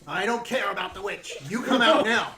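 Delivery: a hint of a bossy girl giving orders; stern command. Gretel orders player out from under the bed, mildly stern.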